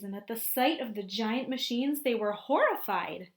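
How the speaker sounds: lively storybook narration for a class; picture book read-aloud voice; reading to children. A narrator's reading. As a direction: read it out to the kids, painting the scene.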